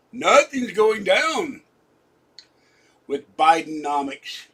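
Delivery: high, loud, slow mocking proclamation; loud slow sarcastic jab at the economy. Real, not acted. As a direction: drag it out with mock outrage.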